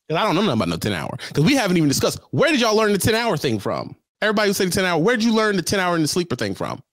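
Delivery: ordinary delivery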